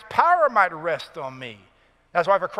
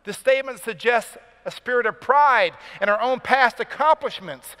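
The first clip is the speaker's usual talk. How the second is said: ordinary delivery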